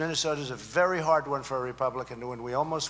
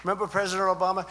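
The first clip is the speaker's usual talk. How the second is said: normal talking voice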